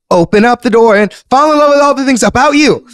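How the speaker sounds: high-pitched rush to cram the syllables in; sped up. Shown as a demonstration. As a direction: squeeze too many words into the line. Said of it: "so you might speed it up".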